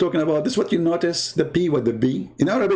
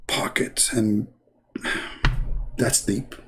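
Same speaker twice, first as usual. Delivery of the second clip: mock whispered pocket; 4 semitones lower, 4 dB quieter, slower than usual. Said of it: "Look how deep he is".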